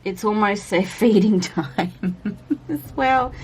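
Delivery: laughing. Real, not acted. Laughing about cats' feeding time.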